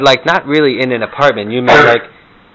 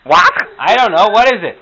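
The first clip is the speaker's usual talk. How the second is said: put-on high, loud, confused old grandma; 11 semitones higher, 5 dB louder than usual. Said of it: "some Morty and Herta imitations".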